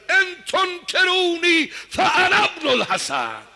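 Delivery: high, loud, slow chanted war boast; a young warrior shouting his lineage to the army; shouted battle cry. Acted. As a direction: declaim it like a hero's rajaz. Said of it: "فریاد زد".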